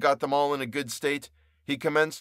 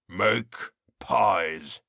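low, slow mocking jailer; jeering croak; 5 semitones lower than usual. A narrator's reading. Jailer mocks the prisoner, low jeering croak. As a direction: sneer the order down at him. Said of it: "The jeering mockery of those tones".

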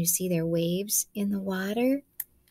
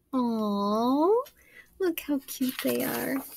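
cooing; 6 semitones higher, slower than usual. Real, not acted. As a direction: coo over babies. Cooing at picture.

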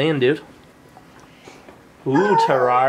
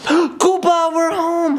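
calling out; 13 semitones higher, much slower than usual. Real, not acted. Calling to dog.

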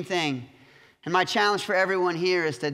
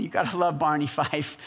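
ordinary delivery

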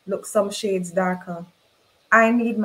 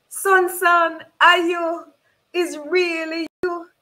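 high, loud cry of disbelief; high-pitched, urgent mother's plea; stunned recognition; 9 semitones higher, 4 dB louder, slower than usual. Acted. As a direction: call out to a long-lost son, pitched high and drawn out. Mother recognises her long-lost son.

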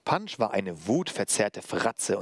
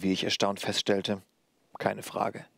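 ordinary delivery, nothing marked

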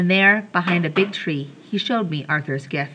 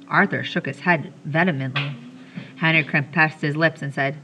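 usual speaking voice

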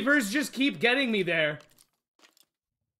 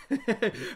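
laugh; 5 dB quieter than usual. Real, not acted. Laughs at misspelling.

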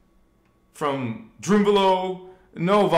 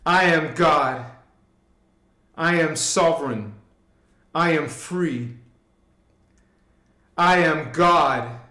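slow, louder solemn declarations; rising fervent affirmation, louder each round; declaring affirmations; 6 dB louder than usual. Shown as a demonstration. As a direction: proclaim each line with conviction, slowly. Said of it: "let's say it, this with me three times".

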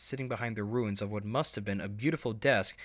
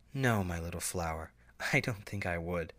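softer, lower tender mother's voice; affectionate coo; 4 semitones lower, 3 dB quieter than usual. Acted. Mother reassuring her daughter, tender soft coo. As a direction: coo it gently to your little one. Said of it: "affectionately cooed".

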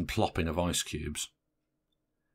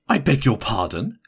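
coldly offended, raised pitch; 6 semitones higher, 6 dB louder than usual. A narrator's reading. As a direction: say it with a touch of icy affront. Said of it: "she said coldly".

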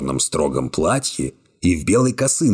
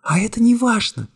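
put-on lightly raised young woman voice, cool and calm; young woman's voice. A narrator's reading. Secretary coolly refusing the hero's embrace, high young woman voice. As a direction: voice her higher, calmly distant. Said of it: "горячо возразила она".

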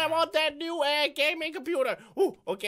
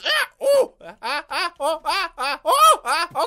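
panicked yelps; 7 dB louder than usual. Real, not acted. A gamer falls off the ladder, panicked laughing yelps. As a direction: yelp and laugh while falling.

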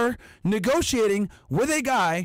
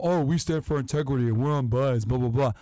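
lower, sneering parody of the channel's pious claim; mock them as you quote their slogan; 8 semitones lower than usual. Podcaster mocking the rival YouTube channel's integrity claim, sneering.

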